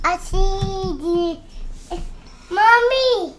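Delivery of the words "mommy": calling out. Real, not acted. Child calling for mother.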